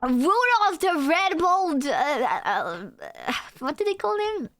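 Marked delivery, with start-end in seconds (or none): 0.0-1.9 higher, slower, drawn-out announcement; dramatic announcing. Pretend. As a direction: announce it like a grand title.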